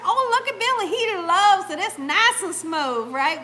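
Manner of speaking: loud, high coaxing imitation; sweet-talking stranger. Lady bragging about Billy on the ride, loud coaxing. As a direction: gush about it in a mimicked voice. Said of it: "That's how she was talking".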